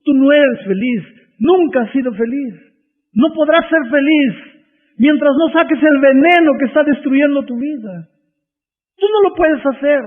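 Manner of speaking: impassioned preacher's rebuke, peaking high; preaching the pastor's words. Retold or imitated. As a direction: cry out the pastor's message to the young man. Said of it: "Y dijo así".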